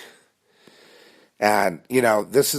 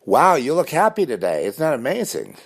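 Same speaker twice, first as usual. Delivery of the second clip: high, bright mock praise; fake-cheery stranger; 9 semitones higher than usual. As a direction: gush at him like a phony fan. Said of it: "the entire city of Los Angeles".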